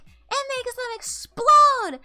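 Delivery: slow, high, very loud yell; screaming. Retold or imitated. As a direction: belt it out at full volume. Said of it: "Wow, you're being really loud".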